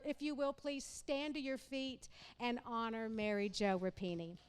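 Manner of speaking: usual speaking voice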